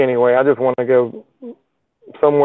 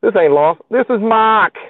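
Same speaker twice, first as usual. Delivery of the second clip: put-on raised-pitch, botched Aussie accent; bad Australian accent; 8 semitones higher, 4 dB louder than usual. Livestreamer trying and failing at an Australian accent on chat. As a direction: fake Australian that slides into Boston. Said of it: "can't do an Australian accent".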